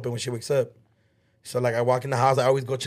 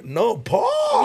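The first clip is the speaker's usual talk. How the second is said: sharp raised call of a name in protest; exasperated shout; 7 semitones higher than usual. Real, not acted. Arguing over what he said.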